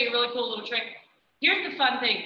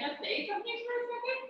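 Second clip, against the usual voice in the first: much higher, soft and quick; high dog talk; 14 semitones higher, 8 dB quieter, faster than usual. Acted. Talking to the distracted dog.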